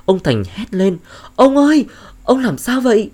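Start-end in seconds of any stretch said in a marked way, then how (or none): 1.4-3.1 high, loud cry of a wife shaking her husband awake; frantic calling. Acted. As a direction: call out in alarm, much higher and louder. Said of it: "Bà Diệu vừa gọi vừa lay chồng".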